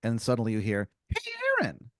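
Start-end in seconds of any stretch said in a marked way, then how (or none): 1.1-1.9 high squeaky hidden voice; squeaky voice calling up to Aaron; mystery voice. Acted. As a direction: call out from below in a tiny high voice.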